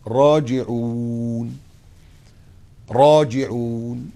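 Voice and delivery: very slow, stretched راجعون rising; slow drawn-out. Acted. Drawn-out recitation. (مد كلمة راجعون ببطء)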